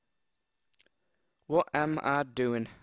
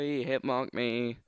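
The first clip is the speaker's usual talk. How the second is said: ordinary talk, nothing marked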